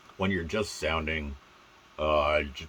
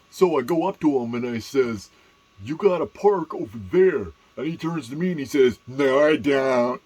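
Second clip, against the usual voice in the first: loud, high put-on character voice; comic storyteller voice; 5 semitones higher, 12 dB louder than usual. Shown as a demonstration. Recounting a parking exchange, loud put-on voice. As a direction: tell it big in a silly voice.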